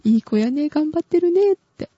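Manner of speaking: put-on praising her computer, sweet high baby-talk; cooing to the computer. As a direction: coo at the laptop like a good puppy. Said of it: "私毎日話しかけてる".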